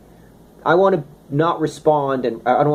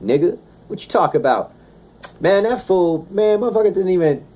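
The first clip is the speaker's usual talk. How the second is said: street slang impression; 6 semitones higher than usual. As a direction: toss off a casual street-talk rant, half-mocking. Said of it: "I talk in a certain way".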